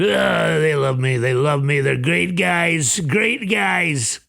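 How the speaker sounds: drawn-out, hammed-up Trump impression; mock narcissist Trump. Trump gushing over his Qatari friends, mocking impression. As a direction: stretch the words like a gushing, self-satisfied Trump. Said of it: "he's an idiot and a narcissist. It's like".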